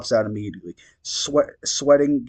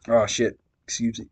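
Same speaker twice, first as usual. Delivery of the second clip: ordinary delivery, nothing marked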